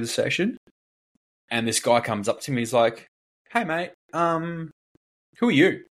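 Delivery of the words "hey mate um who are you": mock challenging guy. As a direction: play the nosy gym guy up high. Imitating confronting gym PT.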